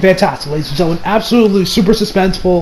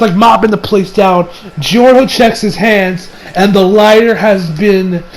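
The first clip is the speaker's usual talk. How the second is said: ordinary talk, nothing marked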